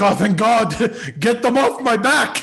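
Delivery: high, loud laughing relief, slowed. Retold or imitated. Older brother laughing, glad the baby takes the heat. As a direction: laugh out the relief of losing a pest. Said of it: "I'm gonna be like".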